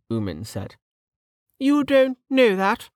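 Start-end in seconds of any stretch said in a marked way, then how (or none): 1.6-2.9 high-pitched, testy lady; high voice, touch of irritation; testy noblewoman. Acted. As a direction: contradict them like a piqued aristocrat. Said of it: "Lady Parr said testily".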